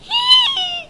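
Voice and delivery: very high, sustained excited squeal; high-pitched squeal. Real, not acted. Fan imagining seeing Tool live, squealing high. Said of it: "did just make that high-pitched noise".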